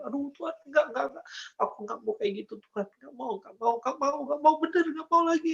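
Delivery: high, repeated pleading without much volume; retold cry of refusal. Telling God he refuses to sin again, retold high pleading. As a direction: beg to be spared, pitch climbing. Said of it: "Aku teriak tapi nggak didengar".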